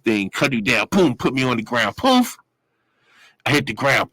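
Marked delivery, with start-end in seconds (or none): 2.0-2.4 thud sound. Retold or imitated. Body hitting the ground.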